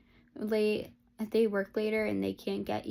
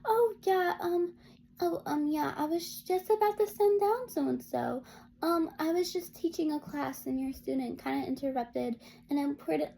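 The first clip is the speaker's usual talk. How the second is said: mock sugary voice, much higher, overly sweet and fake-polite; sweetest voice; 3 semitones higher than usual. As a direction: imitate a teacher faking sweetness to cover her rudeness. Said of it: "said in the sweetest voice".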